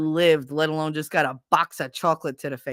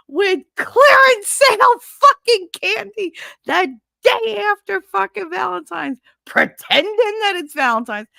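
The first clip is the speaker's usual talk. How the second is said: furious screaming rant; 7 dB louder than usual. Retold or imitated. Pregnant woman yelling at her boyfriend, furious shout. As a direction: scream it out, betrayed and livid.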